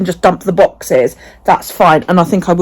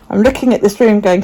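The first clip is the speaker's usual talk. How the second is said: usual speaking voice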